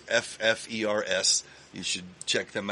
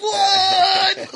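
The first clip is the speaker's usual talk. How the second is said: shocked yelp, much higher and louder; surprised shout; 12 dB louder than usual. Retold or imitated. Fan hearing [name] say his name, shouting in shock. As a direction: blurt it out in disbelief.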